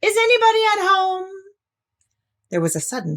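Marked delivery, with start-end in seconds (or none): 0.0-1.3 high, loud and quick into the hole; calling out. Acted. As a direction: call out down a rabbit hole. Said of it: "called out".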